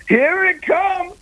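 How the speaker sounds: high, drawn-out imitated call; mock rallying cry, stretched out; retold eager call. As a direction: sing out the quote high and slow. Retold eager welcome of trouble.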